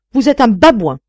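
loud, high-pitched affront; indignant insult. A narrator's reading. Narrator insulting the elector to his face, indignant and loud. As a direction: hurl it as a challenge.

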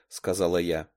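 usual speaking voice

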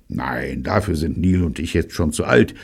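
usual speaking voice

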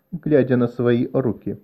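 ordinary delivery

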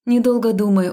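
ordinary delivery, nothing marked

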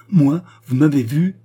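high, loud, disbelieving question; indignant challenge. A narrator's reading. Queen challenging Charny's accusation, loud indignant disbelief. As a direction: fling the accusation back at them.

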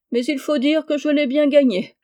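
a monk chuckling at his own punishment; chuckling into his beard. A narrator's reading. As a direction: rire dans sa barbe, plus aigu et plus fort. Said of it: "en riant dans sa barbe".

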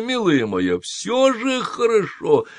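sugary soothing old nanny, lightly voiced; cooing nanny. A narrator's reading. Nanny soothing the patient, a mild cooing voice. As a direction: coax her like a sweet old nurse. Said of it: "заувещевала нянька Персифона, улыбаясь".

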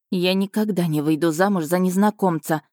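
usual speaking voice

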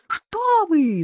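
very high panicked cry; terrified yell, pale with fear; terrified shout. A narrator's reading. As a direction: shout it in horror. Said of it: "закричал Евгений, совершенно бледный от ужаса".

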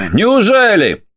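high, mocking exclaimed question; exclamation. A narrator's reading. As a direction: fling it back with a high exclaim. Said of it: "воскликнул доктор Рок". (насмешливое восклицание)

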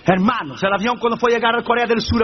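ordinary delivery

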